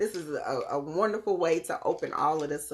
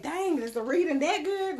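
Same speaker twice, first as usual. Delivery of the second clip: high, mock-impressed viewer; 11 semitones higher than usual. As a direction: play an amazed onlooker, pitched up. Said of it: "Y'all gonna be thinking, like".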